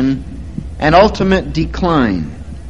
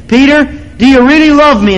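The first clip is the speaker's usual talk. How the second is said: higher and louder, stress on really; Jesus asking again, more urgently; pleading Jesus; 10 semitones higher, 5 dB louder than usual. Retold or imitated. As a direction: press Peter harder the second time. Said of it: "Jesus said it again".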